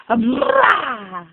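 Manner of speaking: playful peekaboo shout. Acted. Peekaboo game with baby.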